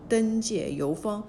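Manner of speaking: plain reading voice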